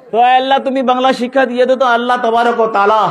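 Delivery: high, quick pleading to God; fervent preaching appeal. Acted. Preacher asking Allah for the Bangla language, fervent.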